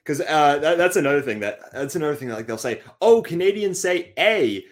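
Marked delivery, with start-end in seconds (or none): 3.0-4.6 high, slow, drawn-out mimicry; mocking imitation. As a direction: parody a clueless outsider, pitched up and louder. Imitating what outsiders say.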